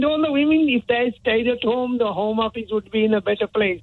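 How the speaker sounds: a mild Indian accent impression of a politician; hint of an Indian accent. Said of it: "I can do the accent".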